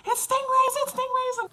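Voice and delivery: high-pitched panicked cry; screamed warning. Retold or imitated. Cousins warning everyone in the water, high panicked scream. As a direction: imitate cousins shrieking an alarm. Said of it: "Two of my cousins start screaming".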